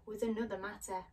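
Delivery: ordinary delivery, nothing marked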